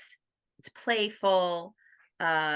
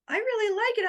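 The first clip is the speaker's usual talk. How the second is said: much higher, sweet earnest praise; imitating the husband's compliment in a high voice; high mock husband. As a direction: play the sweet husband, pitched way up.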